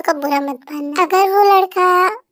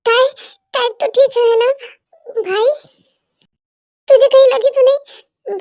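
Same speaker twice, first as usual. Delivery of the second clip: worried shout; 6 semitones higher, 3 dB louder than usual. Acted. Brother rushes in after accident.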